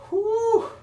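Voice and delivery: high, loud blow of breath mid-rep; loud exhale. Real, not acted. Coach straining through the bridge, winded whew. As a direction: push the air out with the effort. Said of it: "I'm a little bit out of breath".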